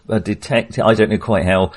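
ordinary talk, nothing marked

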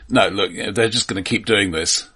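normal talking voice

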